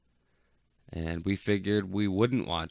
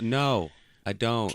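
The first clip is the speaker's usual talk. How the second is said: mock grumpy reply; 5 semitones higher, 6 dB louder, much slower than usual. Mock answer to catchphrase.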